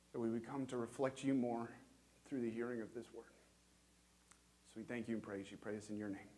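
soft, low pastoral prayer voice. Acted. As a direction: pray gently and humbly to the Lord. Leads a quiet prayer.

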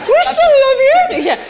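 very high, loud laughing reassurance. Real, not acted. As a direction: gush it through laughter. Laughing reassurance to thirty-year-old.

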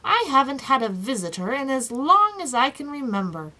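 pleased and animated; happy. A narrator's reading. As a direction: gush happily to a rare visitor. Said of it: "he cried happily".

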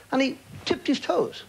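ordinary talk, nothing marked

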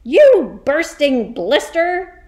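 high, loud, drawn-out insult; yelled. Acted. Headmistress explodes at a slow pupil, yelling. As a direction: yell it slowly, leaning on each word. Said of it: "yelled the Trunchbull".

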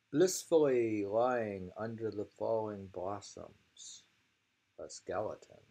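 projected haiku reading; poem reading. Acted. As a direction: present the verse as if to an audience. Reads haiku aloud.

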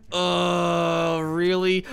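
let down. Real, not acted. First reaction to being attacked.

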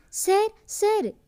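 high, loud, drawn-out call for help; panicked cry for sir, slow and high. Acted. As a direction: call out urgently to him from across the house. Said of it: "ಕೂಗುತ್ತಲೇ ಬಂದಿದ್ದಳು".

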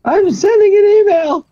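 high, loud exasperated reply. Real, not acted. Answer mid-firefight.

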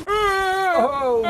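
sharp high, loud cry; startled shout. Real, not acted. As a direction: yelp in surprise. Sudden cry in game.

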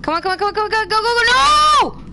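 willing the character to make it, building to a yelp; excited urging. Real, not acted.

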